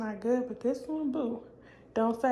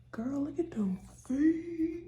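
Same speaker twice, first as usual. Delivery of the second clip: whispering; 5 semitones higher, slower than usual. Real, not acted. Slow whispered aside.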